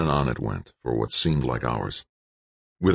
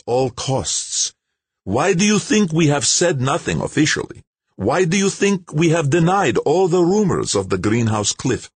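with great patience; 8 semitones higher, 4 dB louder than usual. A narrator's reading. Russian official lecturing the scientist, patiently. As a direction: spell it out to a child. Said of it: "With great patience".

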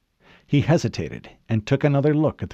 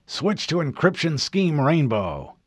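drawling admiral order; 6 semitones higher than usual. A narrator's reading. Admiral giving orders over comm.

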